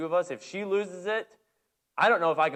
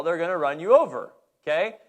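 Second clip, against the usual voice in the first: normal talking voice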